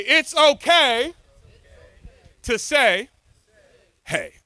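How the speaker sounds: high, loud and slow, fully committed; slow, high full-voiced shout with conviction; shouted like you mean it. Shown as a demonstration. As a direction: belt it out so the room feels it. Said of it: "I want you to say it like you mean it".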